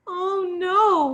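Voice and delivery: high loud wail of dismay. Acted. Princess loses her ball, high loud wail. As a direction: cry out as the ball sinks. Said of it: "wailed the princess".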